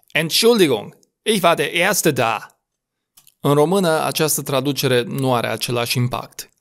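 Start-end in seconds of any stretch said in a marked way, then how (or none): 0.1-2.6 indignant "Entschuldigung" with ENT punched, higher pitch; strong first-syllable stress. Shown as a demonstration. First in line losing patience, stress on ENT in "Entschuldigung". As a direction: hit the Ent- of "Entschuldigung" firmly. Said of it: "pronunțat cu un accent puternic pe primele".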